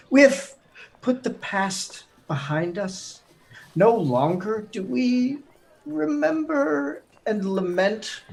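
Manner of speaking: oily courtly politician voice; smug politician. Acted. Turian's fake political speech.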